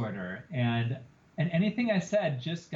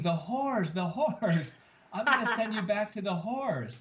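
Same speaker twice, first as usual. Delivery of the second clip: mild mock scream; 5 semitones higher than usual. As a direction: mimic a screaming rant without real volume. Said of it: "running in my office screaming".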